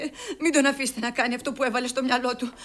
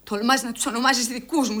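ordinary talk, nothing marked

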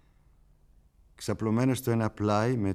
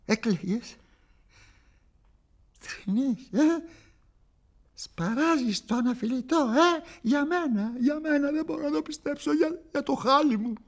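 mocking taunt; 3 dB louder than usual. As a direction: mimic the sob with scorn. Mocking the crying listener. (χλευαστική, ψηλή κοροϊδία)

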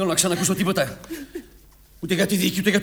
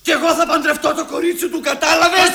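high, heated shouting at his mother; angry shout; 10 semitones higher, faster than usual. Acted. Son to his mother, raised angry shout. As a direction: fight back hard.

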